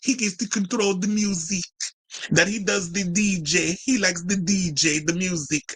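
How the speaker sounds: high mocking voice imitating Melania; prissy imitation of the First Lady bragging. Ranting vlogger mocks Melania bragging about her husband, high mocking voice. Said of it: "Talk about the things that she has to come".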